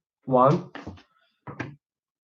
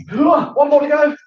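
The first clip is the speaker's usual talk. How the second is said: high, loud cheer; excited shout; 10 semitones higher, 6 dB louder, slower than usual. Real, not acted. Teacher racing the class, shouting the last sock high. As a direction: push for the finish line.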